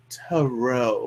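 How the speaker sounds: mock-royal "Tarot", said softly and grandly as ta-ROH; a snooty, lofty "Tarot" like royalty; haughty royal tarot. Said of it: "Like they were some sort of royalty".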